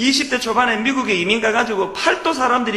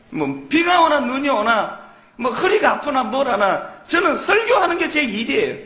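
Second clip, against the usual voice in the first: usual speaking voice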